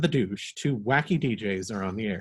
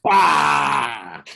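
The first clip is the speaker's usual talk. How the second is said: loud yell; 2 semitones higher, 10 dB louder than usual. Acted. DJ yells at joke.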